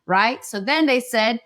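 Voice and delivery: usual speaking voice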